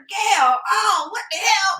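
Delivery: high, loud Southern exclamation; Southern grandmother. Retold or imitated. Woman mimicking her grandmother's talk, loud Southern holler. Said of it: "I talked like my grandmother".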